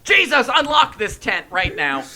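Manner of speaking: high, loud, slow comic scolding; hammed-up furious parent at the door; yelling parent. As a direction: shout each word as an order. Parent yelling at locked-in son.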